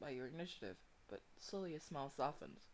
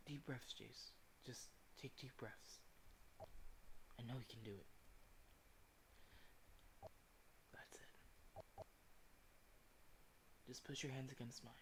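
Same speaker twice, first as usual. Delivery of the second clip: very soft, low reassurance, near a whisper; gentle and breath-soft, as if far away; soothing voice; 4 semitones lower, 14 dB quieter than usual. Acted. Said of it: "A soothing voice echoes far in the distance".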